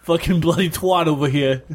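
a silly fake British rant; mock British accent. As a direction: swear like a stage Brit through giggles. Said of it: "I've been doing this accent the whole time".

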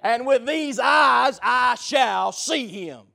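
slow, swelling to a loud cry of faith; Job's defiant faith. Retold or imitated. Job answering his accusers, slow and triumphant. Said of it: "Job said".

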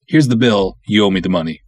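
normal talking voice

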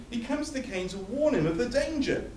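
ordinary talk

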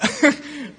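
high, loud burst of laughter. Real, not acted. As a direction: laugh out at your own slip. Laughs at own slip of words.

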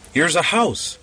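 high relieved call; excited shout. A narrator's reading. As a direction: call out the discovery. Lost man finds shelter.

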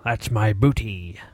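low slow pirate voice. Pretend. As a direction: growl it like a pirate. Pirate bounty joke.

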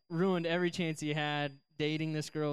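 ordinary talk, nothing marked

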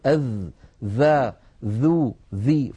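"أذ، ذا، ذو، ذي" one by one, voiced th; tongue tip on teeth for "أذ"; dhal practice drill. Shown as a demonstration. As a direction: model a practice run of "ذا، ذو، ذي", each distinct. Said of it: "فيقول".

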